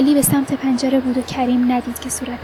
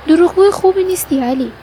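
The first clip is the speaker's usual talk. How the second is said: louder, higher chiding of a friend; narrated rebuke, pitched up; reproachful; 5 semitones higher, 5 dB louder than usual. A narrator's reading. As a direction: call out his fib with raised voice. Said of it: "کریم با شماتت گفت".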